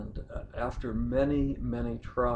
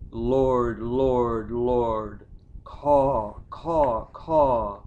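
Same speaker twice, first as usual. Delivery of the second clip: loud lament; 6 dB louder, much slower than usual. Acted. Grieving son crying to the Lord at the grave, loud wail. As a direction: cry out to God, then shriek like crows.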